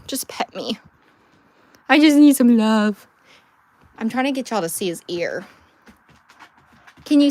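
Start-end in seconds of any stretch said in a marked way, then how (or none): 0.1-0.8 a begging whisper for the dog. Retold or imitated. Owner voicing her dog begging for pets, whispered. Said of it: "He's like". 1.9-3.1 slow, louder, pleading pet voice; needy dog voice. Retold or imitated. Owner voicing her dog asking for love, slow pleading. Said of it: "He's like".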